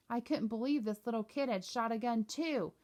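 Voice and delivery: ordinary delivery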